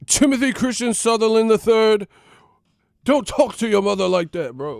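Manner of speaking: high, loud full-name scold. Retold or imitated. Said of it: "They be".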